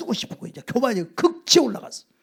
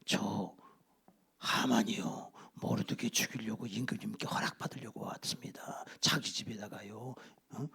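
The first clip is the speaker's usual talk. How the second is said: low, soft, half-whispered report; quiet courtier; 9 semitones lower, 8 dB quieter than usual. Acted. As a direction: lean in and confide it to the king. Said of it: "조용히 신하가 얘기합니다".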